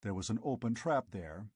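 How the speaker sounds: ordinary delivery, nothing marked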